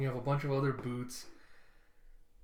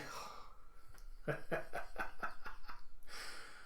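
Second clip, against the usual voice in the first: quiet breathy chuckling; long, soft, voiceless laughter; real laugh; 9 dB quieter than usual. Real, not acted. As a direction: chuckle quietly to yourself at length. Amused reaction to the page.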